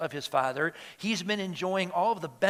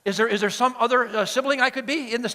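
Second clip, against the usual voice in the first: quick, higher, louder questions; hopeful pleading; 5 semitones higher, 4 dB louder, faster than usual. Acted. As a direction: fish eagerly for a way out. Acted listeners seeking another role.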